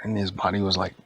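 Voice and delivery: ordinary delivery, nothing marked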